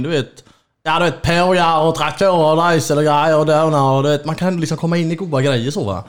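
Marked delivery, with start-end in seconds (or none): 0.8-4.2 a hint of Skåne dialect; lightly slipping into Skåne speech; mild Skåne accent. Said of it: "pratar man med en skåning, då kan jag ändå komma in".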